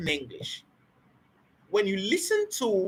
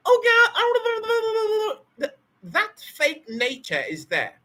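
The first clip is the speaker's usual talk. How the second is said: mock gossip; 11 semitones higher, much slower than usual. Imitating someone judging fake accents.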